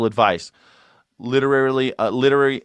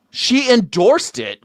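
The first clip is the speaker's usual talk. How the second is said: usual speaking voice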